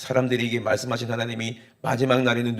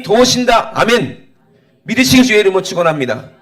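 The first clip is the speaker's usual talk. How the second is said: full-force preaching; 9 semitones higher, 7 dB louder than usual. Real, not acted. Preacher promising God's help to the congregation, full-force blessing. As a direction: build a rousing exhortation to a loud blessing.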